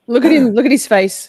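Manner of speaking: loud, high-pitched excitement; loud and high, pointing something out; excited outburst. Real, not acted. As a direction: exclaim at the screen as you spot his face. Reacting excitedly to trial footage.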